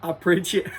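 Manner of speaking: cracking up mid-sentence; giggling through the words; laughing. Real, not acted. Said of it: "I can't even speak now".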